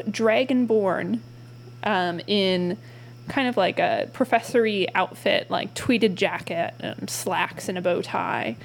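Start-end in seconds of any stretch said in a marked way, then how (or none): none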